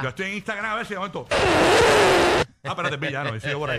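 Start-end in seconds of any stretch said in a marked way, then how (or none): 1.3-2.4 surprised gasp. Retold or imitated. Caught by a sexy profile.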